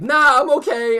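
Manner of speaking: high, loud mock brush-off; imitated breezy refusal. Friend turning down a cop car ride, high-pitched imitated refusal. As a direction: pitch it way up to wave off the offer.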